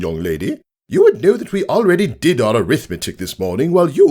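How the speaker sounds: high, stern lecture building reproach; scolding teacher. Acted. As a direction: shame her in front of the class. Teacher scolding pupil before class.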